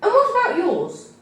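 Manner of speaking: high, probing official question; social worker voice. A narrator's reading. Social worker asking about the parents' health, high and probing. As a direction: lift into the worker's prying tone.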